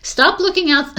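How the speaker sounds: high, loud playful scold. Real, not acted. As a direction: call it out high and sharp. Scolding someone off camera.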